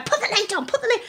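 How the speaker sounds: high, urgent retold command; frantic urging. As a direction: push him to hurry, panicked. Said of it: "I'm going".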